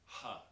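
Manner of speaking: "ha" with a breathed h for the C; Tuscan aspirated C. Shown as a demonstration. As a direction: say "ha" with an open h. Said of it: "instead of the C's, they'll say".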